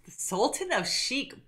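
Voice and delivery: ordinary talk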